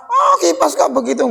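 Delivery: mock complaining. Acted complaint at the fan.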